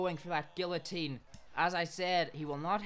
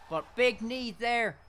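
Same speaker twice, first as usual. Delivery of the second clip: high, loud commentator yell; excited shout; 12 semitones higher, 4 dB louder than usual. Real, not acted. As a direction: roar it out as the knockdown lands.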